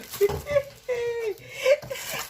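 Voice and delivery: high breathless giggle; giddy laugh. Real, not acted. Overjoyed unboxer giggles at her gift. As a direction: laugh out in delight.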